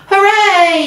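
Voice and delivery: high, loud cheer; crowd yell. Acted. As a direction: shout it like a whole grandstand. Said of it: "yelled the crowd".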